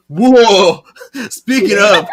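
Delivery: loud, high, drawn-out whoa; mock alarm. Host reacts to warning signs in the story, mock alarm. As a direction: shout it in comic alarm.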